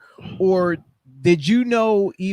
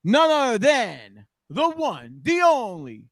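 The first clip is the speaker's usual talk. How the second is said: drawn-out, raised-pitch hype building to a shout; ring announcer intro; 7 semitones higher, 4 dB louder, slower than usual. Acted. Host introducing T Reyes as surprise guest, ring announcer hype. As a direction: announce the wrestler, stretching words up to a big name shout.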